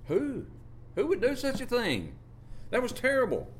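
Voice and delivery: preacher acts out the disciples' shocked reaction; shocked disbelief. Pretend. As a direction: gasp it out as a horrified disciple.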